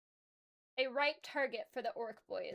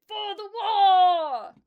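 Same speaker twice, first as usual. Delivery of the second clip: huge, drawn-out, much louder and higher battle roar; shouted; 8 semitones higher, 15 dB louder, much slower than usual. Acted. Warboss charging forward, roaring a slow, loud war cry. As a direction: bellow it slowly across the battlefield. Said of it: "pointed his chopper forward and shouted".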